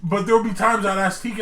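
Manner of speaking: ordinary talk, nothing marked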